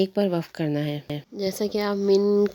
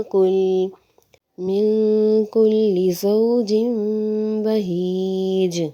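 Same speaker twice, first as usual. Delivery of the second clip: slow, melodic tajweed chanting; Quran recitation; slower than usual. Shown as a demonstration. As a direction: recite slowly, holding the long vowels. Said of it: "سورہ حج کی آیت پڑھتی ہوں". (سورہ حج کی آہستہ تلاوت)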